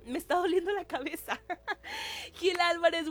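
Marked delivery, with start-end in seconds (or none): 1.4-2.3 brief high-pitched laugh; high giggle. Real, not acted. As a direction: let out a squeaky giggle. Laughing at own complaint.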